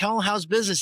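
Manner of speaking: high, loud, chirpy hello; bright mock greeting. Greeting old boss Romeo in the shop, mock chirpy. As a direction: greet him with exaggerated cheer. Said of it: "And I'm like".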